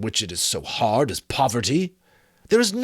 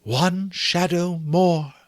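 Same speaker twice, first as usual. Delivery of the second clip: ghost's exclamation; 6 semitones higher, much slower than usual. A narrator's reading. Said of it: "exclaimed the ghost".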